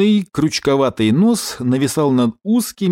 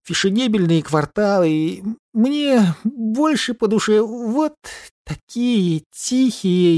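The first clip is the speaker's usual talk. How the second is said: porcupine voice; 5 semitones higher, slower than usual. Acted. Porcupine keeps holding forth.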